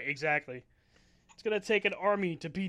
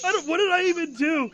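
high whiny fake crying; 11 semitones higher, 9 dB louder than usual. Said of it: "He's like".

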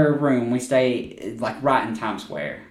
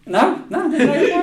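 much higher, laughing mother's reply; lightly voiced mother; 14 semitones higher than usual. Mother hit in the face, high laughing reply. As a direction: brush it off like a brave mom. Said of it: "She's like".

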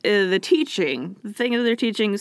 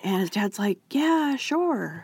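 whispering; 4 dB quieter than usual. Real, not acted. Whispered aside about dad.

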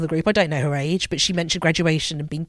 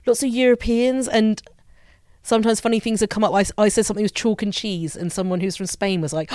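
normal talking voice

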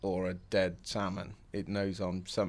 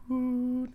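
gentle hoot. Retold or imitated. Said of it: "And I'm like".

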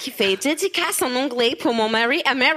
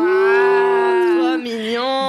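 very slow, louder gushing; drawn-out gush; 2 semitones higher, 4 dB louder, much slower than usual. Real, not acted. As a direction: stretch it out adoringly. Gushing over the dedication. (très attendri)

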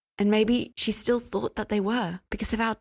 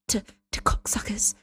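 offended hushed whisper; 2 semitones higher than usual. Acted. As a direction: whisper the word as if it offends you. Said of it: "She whispered the word cocksucker".

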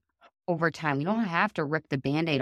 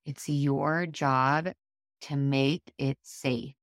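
ordinary delivery

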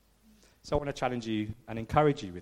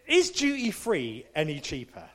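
ordinary talk

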